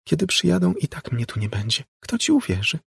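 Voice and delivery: put-on high-pitched child voice; taunting little girl. A narrator's reading. Ghostly girl shrugs off the police threat, high taunting voice. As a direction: dismiss the threat in a girlish pitch.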